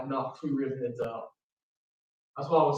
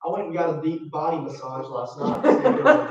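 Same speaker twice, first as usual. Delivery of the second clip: laughing; 2 semitones higher, 7 dB louder, faster than usual. Real, not acted. Laughing through pain story.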